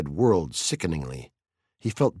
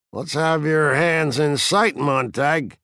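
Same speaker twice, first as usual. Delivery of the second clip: higher, drawn-out teasing command; taunting fire captain; 6 semitones higher, 3 dB louder, slower than usual. A narrator's reading. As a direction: needle him, pitched up and slow. Narrator voices taunting Beatty.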